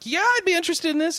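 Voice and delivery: high-pitched eager acceptance, acted out; eager quote. Host retelling his reply to a student's offer, eager.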